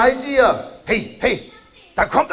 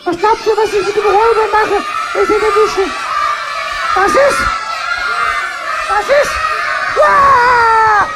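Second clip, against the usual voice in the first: Kasperle shouting; 11 semitones higher than usual. Acted. Kasperle rushing in against the robbers, shouting high.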